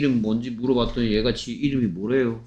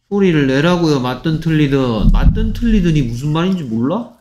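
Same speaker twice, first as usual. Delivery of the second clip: raised voice, insistent nagging; exasperated scolding; 3 semitones higher, 4 dB louder than usual. Real, not acted. Teacher scolding a pupil who won't answer aloud, exasperated. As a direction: press the pupil loudly to speak up.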